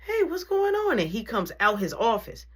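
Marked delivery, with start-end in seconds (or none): 0.1-1.0 high-pitched imitation of the principal; startled principal. Principal coming to the door at the commotion, high-pitched questioning. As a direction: play the principal, voice shot up high. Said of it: "he comes to the door".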